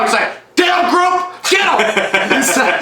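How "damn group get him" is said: high shouted command, "get him"; mock battle cry. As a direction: yell the order to the group. Acted cult leader ordering attack.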